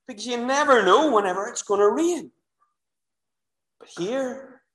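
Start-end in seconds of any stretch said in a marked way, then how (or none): 3.8-4.6 soft dramatic whisper; hushed pause before the point. Real, not acted. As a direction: drop to a whisper for effect. Hushed turn in sermon.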